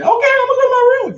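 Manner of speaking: put-on high, loud, fast child voice giving in; meek little kid. Mother mimics her son meekly obeying, high and fast. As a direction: play the kid who gives up. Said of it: "child giving in".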